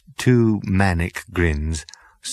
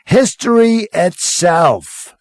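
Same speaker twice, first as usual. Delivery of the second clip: high, slow, drawn-out thrill; excited Zaphod; 9 semitones higher, 4 dB louder, slower than usual. Acted. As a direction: gush like a dazzled party boy. Zaphod completes.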